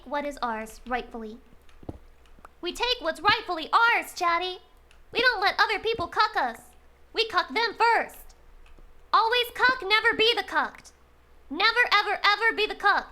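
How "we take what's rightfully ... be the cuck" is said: high, loud, emphatic declaration; mock rallying cry. Streamer urging chat to take what's rightfully theirs, loudly rallying. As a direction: rally chat like troops, hammed-up.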